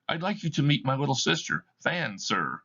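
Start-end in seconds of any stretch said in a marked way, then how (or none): none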